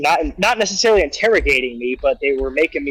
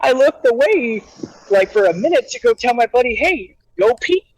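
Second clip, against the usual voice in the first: high-pitched pleading protest; worked-up, high voice of mock despair; retold near-tears panic; 9 semitones higher than usual. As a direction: re-enact pleading innocence on the verge of crying. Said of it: "I'm on the verge of crying".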